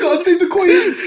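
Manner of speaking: high, loud hammed-up British; over-the-top British send-up; mock British accent. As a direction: proclaim it in squeaky fake British.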